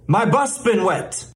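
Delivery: "My bus been wet" peaking on "bus"; stress on BUS. Shown as a demonstration. Mock teacher has the class repeat, stressing "bus". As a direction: model it with "bus" lifted and the rest falling. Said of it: "Say it".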